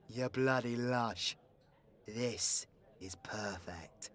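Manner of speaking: low, soft, settling after a yell; quiet aside. Acted. Outlaw to drinking friend while plotting, low and quiet. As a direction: drop the voice right down.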